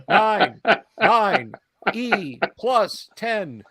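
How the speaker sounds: hollow, high metallic robot voice; metallic voice filter. Acted. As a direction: speak like a hollow-sounding machine. Robot keeps speaking.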